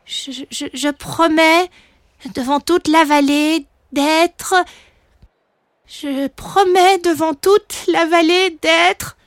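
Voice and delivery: high, slow, halting pledge; faltering little dragon. Acted. Son struggles to repeat his father's oath, hesitant and high. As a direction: stammer the start, then stall on the last word.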